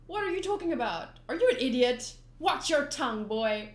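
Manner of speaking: annoyed shout. Acted. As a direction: snap back, offended. Reads manga dialogue aloud.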